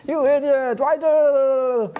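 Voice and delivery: high, loud, hammed-up yelling; mock outburst. Devotee blowing off steam at a friend, hammed-up anger. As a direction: let the anger spill out in fun. Acted quarrel, venting anger.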